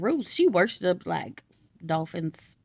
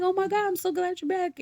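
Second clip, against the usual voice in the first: excited greeting; 11 semitones higher than usual. Retold or imitated. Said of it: "they were so happy".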